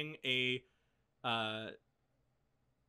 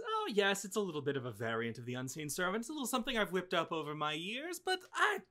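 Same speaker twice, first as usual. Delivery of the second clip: higher, rattling grandmotherly chatter, fast; doting old hostess, quick; 7 semitones higher, faster than usual. Acted. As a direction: rush through it like a busy grandma. GM voices Ruth, quick and chatty.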